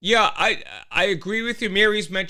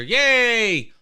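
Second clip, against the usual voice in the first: cheer; 7 semitones higher, 6 dB louder than usual. Real, not acted. Greeting Andre in chat.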